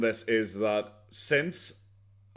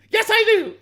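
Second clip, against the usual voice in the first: much higher, louder squealed parody through laughter; exaggerated girly squeal; 9 dB louder than usual. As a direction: push the mockery to a shriek. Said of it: "she's just such an actress".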